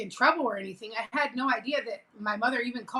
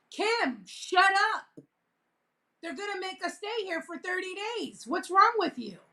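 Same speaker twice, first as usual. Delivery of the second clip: scared scolding; 6 semitones higher than usual. Retold or imitated. Scared kid scolding sister.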